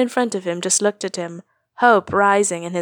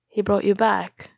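usual speaking voice

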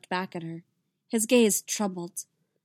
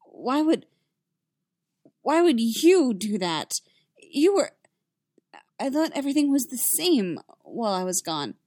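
higher, louder, halting plea; distressed disbelief; 4 semitones higher, 4 dB louder than usual. A narrator's reading. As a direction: stumble over it, upset and pleading. Shocked Anakin confronts Obi-Wan.

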